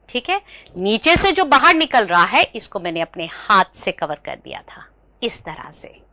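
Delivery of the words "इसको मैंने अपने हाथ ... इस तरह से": whisper. Acted. Reveals trick secretly.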